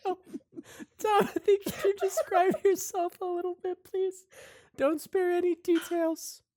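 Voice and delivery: very high, loud prompting; shrieky show host. Acted. Savannah J asks Tomothy to describe himself, squealing host. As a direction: squeal the request at your guest. Host presses guest on camera.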